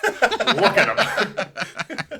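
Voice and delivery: slow laughing repeat. Real, not acted. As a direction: laugh through the words, drawn out.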